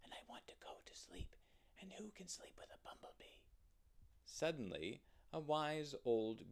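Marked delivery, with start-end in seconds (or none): 0.0-3.4 sleepy, voiceless whispered complaint. Acted. Sleepy rabbit with bee in his throat complains, whispering. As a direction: whisper it all, with no voice. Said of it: "whispered the rabbit".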